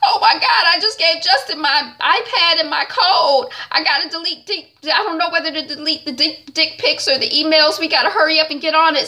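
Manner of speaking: high, laughing frantic rush; panicked. Acted. Mary Jane panicking to her friends.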